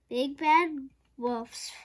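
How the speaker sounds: ordinary delivery, nothing marked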